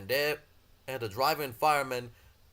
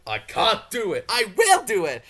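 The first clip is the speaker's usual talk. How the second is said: alternating puffs; 5 semitones higher, 8 dB louder than usual. Acted. Engines puffing in alternation.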